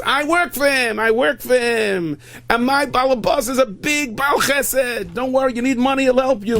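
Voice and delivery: high, loud, drawn-out bragging; gushing employee talking up the boss; excited boasting. Acted. Acted boastful worker praising his boss.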